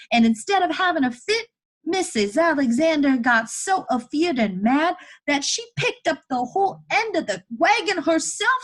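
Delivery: put-on high, loud boy telling it urgently; desperate, excited boy. Boy proving to chained woman he can free her, desperate. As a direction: argue at full volume, voice pitched well up. Elijah argues with story.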